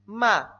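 "Ma" loud, falling sharply from high; fourth tone. Shown as a demonstration. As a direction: drop "Ma" hard from the top. ("Ma" en cuarto tono, caída fuerte)

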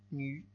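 "Nu" with a French u vowel; u francesa. Shown as a demonstration. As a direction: say "Nu" with rounded-lip French u. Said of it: "Y es la U francesa". ("Nu" con u francesa)

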